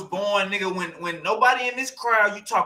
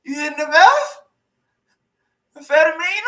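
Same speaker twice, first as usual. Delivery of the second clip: higher, louder put-on voice of fake shock; mock shocked voice; 7 semitones higher, 7 dB louder than usual. Streamer teasing chat about meth in a mock shocked higher voice. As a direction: gasp it out like scandalized.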